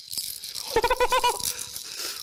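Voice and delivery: high airy laugh of relief; relieved breathless laugh. Real, not acted. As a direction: laugh out the tension.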